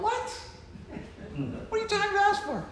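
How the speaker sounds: very high, offended squeal of disbelief; shocked, whiny what-us protest. Retold or imitated. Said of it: "And they're going".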